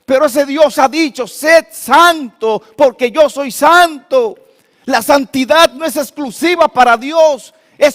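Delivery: loud, high, fervent sermon voice; preaching at full force. Acted. Pastor exhorting the congregation against fear, fervent full-voiced preaching. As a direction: drive the warning about fear home to the church.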